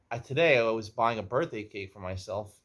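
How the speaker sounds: ordinary delivery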